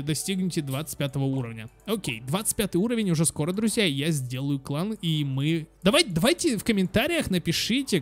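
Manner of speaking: normal talking voice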